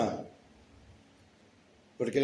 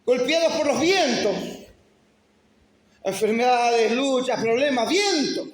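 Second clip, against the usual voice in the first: high, loud preaching, each phrase pounded; pulpit preaching; 8 semitones higher, 4 dB louder than usual. Acted. Pastor stirring the congregation about life's storms, fervent preaching. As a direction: preach it like storms are hitting.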